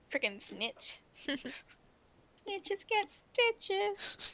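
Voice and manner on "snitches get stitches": drawn-out catchphrase voice; slow put-on voice. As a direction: stretch it out slowly, softly.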